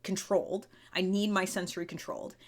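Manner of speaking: ordinary talk, nothing marked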